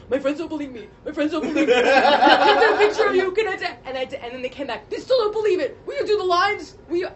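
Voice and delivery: put-on high, loud, eager kid pleading; excited kids. Cousins begging the actress for a picture, high and excited. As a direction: beg like an excited fan kid.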